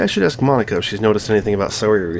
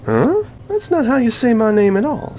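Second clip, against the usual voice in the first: higher, put-on girl's voice for Monika; playing Monika; 13 semitones higher than usual. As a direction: read Monika's lines in a lifted, girlish voice. Voicing Monika in visual novel.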